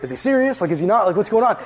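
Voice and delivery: acted-out bafflement, pitch jumping up; bewildered. Visitor baffled by the kid's threat, bewildered. Acts out own bewildered reaction.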